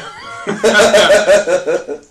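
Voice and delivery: big burst of laughter; loud laugh. Real, not acted.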